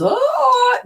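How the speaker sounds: a sharp high startled oh; alarmed gasp. Retold or imitated. Retelling her mind's panic, a high startled oh. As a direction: yelp as fear hits you. Mind jumping to fear.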